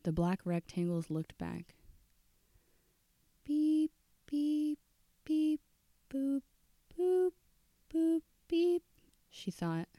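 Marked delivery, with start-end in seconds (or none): 3.4-8.8 very high sung dial beeps; imitated phone tones. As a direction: chime the keypad tones like a phone. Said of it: "her mind played the tones back".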